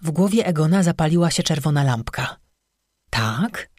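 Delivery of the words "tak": low, prompting question; lowered, questioning nudge; encouraging. A narrator's reading. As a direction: gently prompt for more in a lowered voice. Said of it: "zachęcił".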